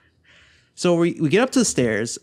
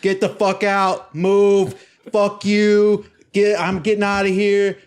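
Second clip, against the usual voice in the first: loud, high barked commands; retold angry yell, each order held out; upset shouting; 7 semitones higher, 4 dB louder, slower than usual. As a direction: act out shoving through a crowd. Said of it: "I was so upset".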